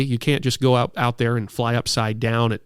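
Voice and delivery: usual speaking voice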